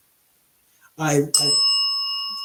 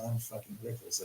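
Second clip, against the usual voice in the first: breathed out nearly silently; hushed starstruck awe; 2 semitones lower, 15 dB quieter than usual. Retold or imitated. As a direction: awestruck fan, almost silent. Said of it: "I said".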